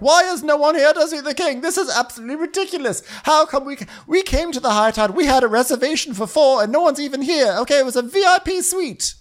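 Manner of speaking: very high, loud indignant whine; outraged complaint. Retold or imitated. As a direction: complain like an outraged VIP guest. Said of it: "He's like".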